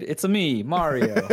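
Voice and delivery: Mario voice. Retold or imitated. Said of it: "No one has done a Mario voice like this".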